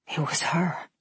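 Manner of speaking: breathless whisper of disbelief; stunned whisper. A narrator's reading. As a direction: barely breathe the words, stunned. Shock on seeing dead friend.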